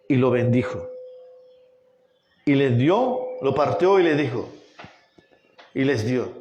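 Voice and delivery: low, measured reading with long pauses; hushed-down, deliberate Bible narration; solemn scripture reading. Acted. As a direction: read the verse reverently, phrase by phrase. Reads the Scripture passage aloud.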